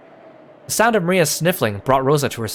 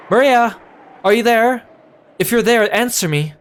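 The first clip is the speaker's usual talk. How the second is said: shouting out into the wind for her daughter; a mother yelling across a stormy garden; frantic calling; 8 semitones higher, 4 dB louder than usual. Acted. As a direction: call her name loud and high, desperate.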